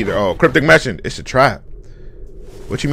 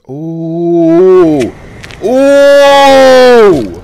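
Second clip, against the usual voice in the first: long oohs climbing higher and louder; amazed ooh; 5 semitones higher, 9 dB louder than usual. Real, not acted. Watcher amazed by what appears on screen, loud ooh. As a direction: draw out a rising, awestruck ooh.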